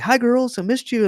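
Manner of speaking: put-on high-pitched singsong hello to little kids; doting father greeting. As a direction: imitate a dad cooing to his daughters. Father greeting daughters, acted.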